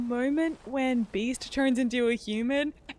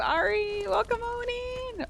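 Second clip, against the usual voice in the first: sing-song greeting; 12 semitones higher, slower than usual. Real, not acted. Greeting a new viewer.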